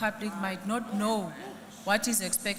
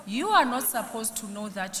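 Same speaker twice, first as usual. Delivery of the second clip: usual speaking voice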